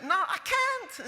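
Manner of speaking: high, drawn-out mock Scottish refusal; little Scottish accent. Student resisting her teacher's persuasion, mock Scottish accent. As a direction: refuse in an imitated small Scottish voice. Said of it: "in her little Scottish accent".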